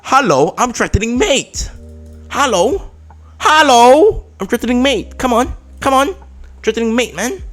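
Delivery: high-pitched, exasperated hello-is-anyone-there; incredulous calling out. Retold or imitated. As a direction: call out in high disbelief. Acts out calling at opponent, indignant.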